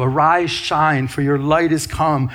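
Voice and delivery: slow, weighty repeat of Arise, shine; slow emphatic repeat. Real, not acted. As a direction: let each word of the verse land. Repeats the verse alone, slowly.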